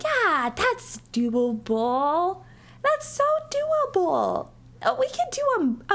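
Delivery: high, breezy overconfident voice; mock eager. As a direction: sound carefree and naive. Mocking own eager group.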